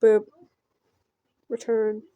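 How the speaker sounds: ordinary talk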